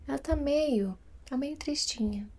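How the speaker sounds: soft, gently sad voice; understated sad tone; a touch of sadness. Retold or imitated. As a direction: sympathetic hushed murmur. Voicing a gently sad girl.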